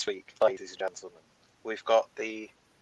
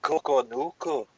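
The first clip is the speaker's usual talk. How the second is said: put-on Glaswegian accent, a slightly crap Billy Connolly; quick Glaswegian accent try; 2 semitones higher than usual. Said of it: "in a Glaswegian accent?".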